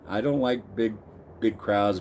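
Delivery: usual speaking voice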